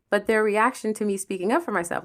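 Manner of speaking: usual speaking voice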